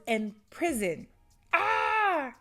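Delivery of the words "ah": high exasperated cry of anger; pitched-up outraged ah; frustrated cry. Real, not acted. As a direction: let out a real frustrated yelp. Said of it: "I'm so mad".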